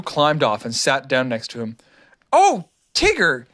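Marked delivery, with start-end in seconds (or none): 2.3-3.5 high, drawn-out thrilled cry; slow, high gasp of excitement as little Roo; excited. A narrator's reading. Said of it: "he said excitedly".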